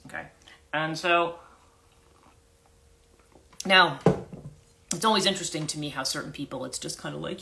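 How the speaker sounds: normal talking voice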